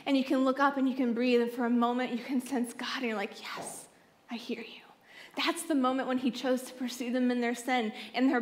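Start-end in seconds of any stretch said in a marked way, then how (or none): 3.4-4.8 hushed. Pretend. Hushed reply to sensing God.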